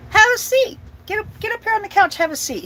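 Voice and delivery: high, fast dad ordering her onto the couch; hammed-up dad voice. Dad telling his little daughter to sit on the couch, high voice.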